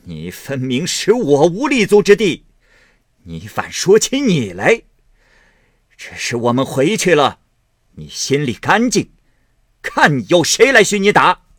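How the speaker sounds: loud, high sarcastic retort; cold sneering anger. A narrator's reading. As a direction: sneer and spit the words back. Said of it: "贾母冷笑道".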